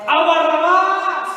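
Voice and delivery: high, loud, slow drawn-out yell; the preacher voicing the crowd roaring for Barabbas; crowd shout. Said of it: "¿Qué gritó la gente?".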